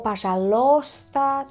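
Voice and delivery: пожалуйста wrongly as "pa-zha-LOS-ta"; slow, mistaken "pa-zha-LOS-ta"; mispronunciation. Shown as a demonstration. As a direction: swap the U of пожалуйста for an O. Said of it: "también he escuchado varias veces decir eso".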